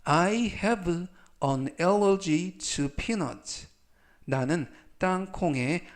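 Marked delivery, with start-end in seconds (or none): 0.0-3.7 slow, carefully spaced English sentence; slow model reading. Shown as a demonstration. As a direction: read each word slowly for learners. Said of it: "즉, 전체적 의미는".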